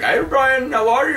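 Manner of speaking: high, loud imitated Indian accent; mock Indian accent. Host imagines guest returning, high mock Indian accent. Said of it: "Amanda will be like".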